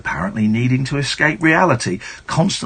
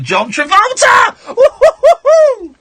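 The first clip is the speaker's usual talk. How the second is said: excited whooping; 9 dB louder, slower than usual. Pretend. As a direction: shout the name, then high whoops. Gleeful announcement of celebrity name.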